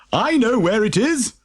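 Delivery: a cheery knowing chuckle; lightly voiced jolly postman; chuckling postman. A narrator's reading. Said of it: "chuckled the jolly postman".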